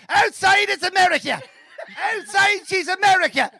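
put-on high Cavan accent, laughing. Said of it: "in the Cavan accent".

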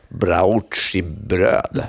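"Brauchi-bröd" very slow, as brao-tchi-bröd; mock mispronunciation. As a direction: stretch out "Brauchi-bröd", copying the ad's botched word. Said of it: "kan säga det där".

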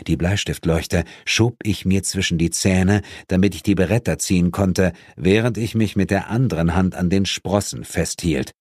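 plain reading voice

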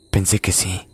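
ordinary delivery

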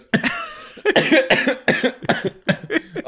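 coughing fit after swallowing wrong; hacking cough, choking. Real, not acted. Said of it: "jetzt hab ich mich verschluckt".

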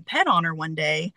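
ordinary delivery

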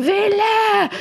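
a high mock roar of the name, half-acted; retold roar. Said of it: "det vrålet".

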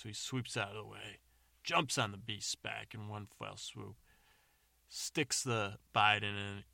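plain reading voice

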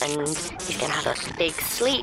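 put-on creepy teenager calmly explaining; teenage boy. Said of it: "whiny teenage boy voice".

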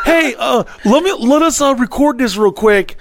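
high fake-nice voice to the unwanted guest; fake-sweet voice. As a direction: talk up high and sweet to get rid of her.

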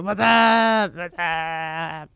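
drawn-out mock "wassup"; imitating advert guys. Imitating two guys answering the phone, drawn-out catchphrase. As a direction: bellow the first, stretch both out. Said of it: "they answer the phone and they go".

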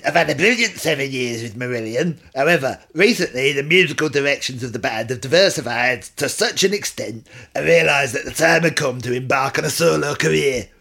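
lightly put-on, not very Scottish accent; a hint of the Scottish singer, half-hearted. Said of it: "It's not even Scottish".

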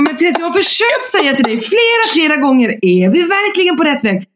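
exasperated husband. Retold or imitated. Husband repeating his warnings.

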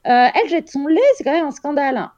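high, scandalised gossip voice; gossiping nurse. Retold or imitated. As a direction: play the outraged nurse telling others. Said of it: "en disant".

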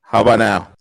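robotic, glitchy clipped audio; distorted, stretched-out voice; clipping distorted mic. Real, not acted. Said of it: "No, it's still, it's still messed up".

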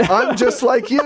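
very high mock voice breaking into laughter; silly high pitch, pushed and giggly; put-on comedian voice. Rich comedian appealing to working-class crowd, silly voice. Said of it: "I won't do any voices".

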